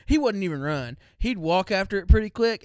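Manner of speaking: usual speaking voice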